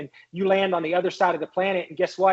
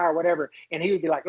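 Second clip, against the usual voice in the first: ordinary delivery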